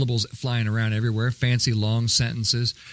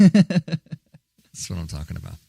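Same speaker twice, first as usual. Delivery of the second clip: John McClane impression; 2 semitones lower than usual. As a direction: growl it out like a tough movie detective. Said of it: "I'm gonna do my John McClane".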